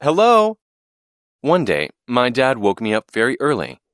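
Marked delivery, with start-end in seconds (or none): 0.0-0.6 high, loud called-out hello; shout. A narrator's reading. Boy calls hello to pilots flying over the farm, shouting. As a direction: call up to someone far overhead. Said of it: "wave at the pilots and shout".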